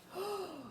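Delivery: quick dramatic gasp; sharp gasp. Acted. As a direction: gasp for the kids. Reader gasps at the threat.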